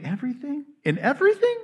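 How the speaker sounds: disbelieving echo, leaping high at the end; incredulous questioning. Acted. Doubting listener questions the verse, incredulous rising echo. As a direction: query "In everything?" with a steep rise.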